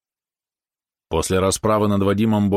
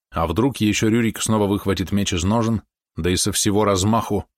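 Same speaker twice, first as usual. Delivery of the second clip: ordinary delivery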